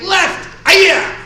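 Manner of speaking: sharp high martial-arts yell; kiai shout. Shown as a demonstration. Instructor leading class drill, loud kiai shout. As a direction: shout the strike out from the gut.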